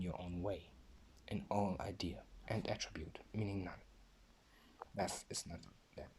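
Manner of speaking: hushed, breathy whisper. Real, not acted. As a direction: drop to a bare whisper. Voice drops to a whisper.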